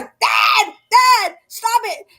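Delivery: high-pitched mock yell of a daughter, drawn out; imitating daughter yelling. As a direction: play the fed-up daughter shouting at dad. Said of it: "my daughter's going".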